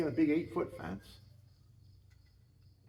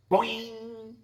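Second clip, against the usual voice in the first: arrow boing; 10 semitones higher, 8 dB louder than usual. Retold or imitated. Arrow hits the tree.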